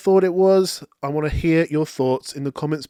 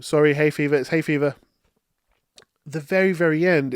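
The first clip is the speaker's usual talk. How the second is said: ordinary talk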